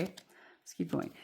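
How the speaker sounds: hushed whisper to herself, moving on; whispered aside. Real, not acted. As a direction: murmur it to yourself. Aside while turning cards.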